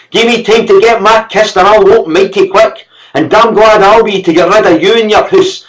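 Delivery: high, loud furious yelling; angry scream. A narrator's reading. Servant screaming at the master as he quits. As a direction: let the anger boil over. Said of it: "screaming now that anger".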